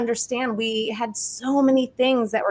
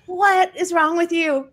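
higher, slow exasperated question; 7 semitones higher, slower than usual. Retold or imitated. Speaker reacting to backward attitudes, slow and exasperated. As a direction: drag it out in disbelief. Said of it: "And I'm like".

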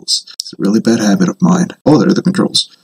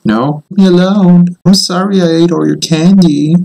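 mock pleading; 7 semitones higher than usual. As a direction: beg for mercy, half joking. Begging the chasing witch.

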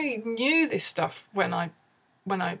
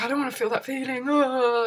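acted panicky rush, higher and quick; frantic; 5 semitones higher than usual. Anxious person fighting a feeling, frantic. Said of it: "It's kind of this frantic, like".

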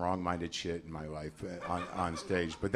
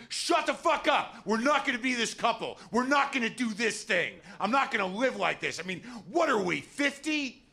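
exploding at wife; 14 semitones higher, 3 dB louder than usual. Retold or imitated. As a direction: blow up at her in a high mock yell. He snaps back at her.

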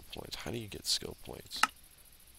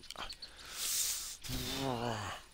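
long, high frustrated groan; exasperated groan; 11 semitones higher than usual. Real, not acted. Player fed up with the game, exasperated groan. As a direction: let out a drawn-out, high noise of frustration.

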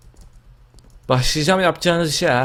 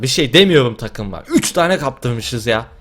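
ordinary delivery

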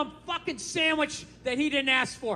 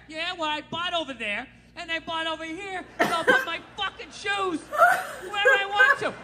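higher, building to a laughing shout; mock defiant retort; 6 semitones higher than usual. Husband answering wife about his shoes, mock defiant shout.

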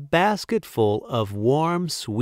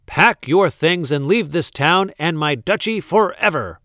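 loud, raised-pitch angry command; shouting; 5 semitones higher, 5 dB louder than usual. A narrator's reading. Duke banishes the baker, angry shouting. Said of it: "shouted the Duke".

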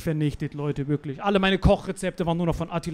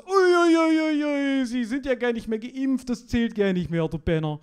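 bouncer; 7 semitones higher than usual. Retold or imitated. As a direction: play the shocked door guard pitched up. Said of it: "der so". (Türsteher, hoch und laut gespielt)

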